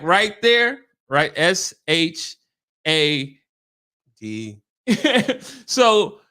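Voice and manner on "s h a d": slowly spelled letter by letter, S H A D; spelling out letters. Retold or imitated. Spelling the word out slowly to the gym client. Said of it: "I was just like".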